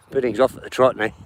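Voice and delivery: usual speaking voice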